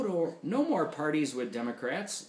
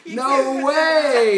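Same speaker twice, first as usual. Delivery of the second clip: high, loud crowd protest; big drawn-out shout of refusal; storybook yell; 11 semitones higher, 14 dB louder than usual. A narrator's reading. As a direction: yell it out as the whole throng. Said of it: "yelled the throng".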